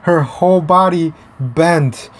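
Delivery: usual speaking voice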